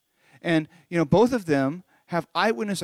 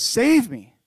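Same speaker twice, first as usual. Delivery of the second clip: higher, louder mock cry for help; 5 semitones higher, 6 dB louder than usual. Peter calling on Jesus, acted cry for rescue. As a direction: plead out loud, as if sinking. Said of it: "just cry out".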